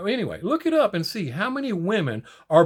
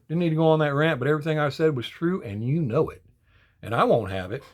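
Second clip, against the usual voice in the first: ordinary talk, nothing marked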